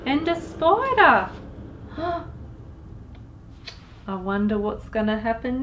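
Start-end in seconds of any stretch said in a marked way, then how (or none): 1.3-2.7 high airy gasp of mock shock; breathy mock gasp. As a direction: gasp as if spooked. Spider added to cauldron.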